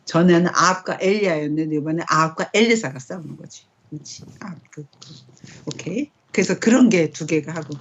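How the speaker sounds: ordinary talk, nothing marked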